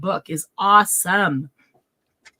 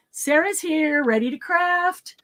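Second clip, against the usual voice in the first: normal talking voice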